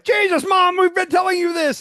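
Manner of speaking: high, loud mock kid yell; exasperated kids. Kids fed up with their mom in a skit, shouted exasperation. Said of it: "they were like".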